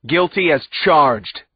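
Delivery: loud, slow roared verdict. A narrator's reading. Judge delivering the verdict to the accused, slow loud roar. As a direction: bellow it down at them. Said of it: "he roared".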